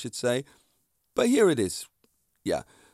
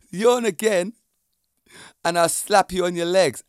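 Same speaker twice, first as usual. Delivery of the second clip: laughing; 4 semitones higher, 5 dB louder than usual. Real, not acted. Laughing threat.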